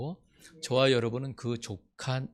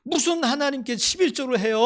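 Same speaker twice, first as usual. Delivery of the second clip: high, loud indignant protest; boy shouting at his sick mother; angry child; 12 semitones higher, 7 dB louder than usual. Retold or imitated. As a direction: rage at the unfairness. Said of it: "이렇게 막 화를 냈다는 거야".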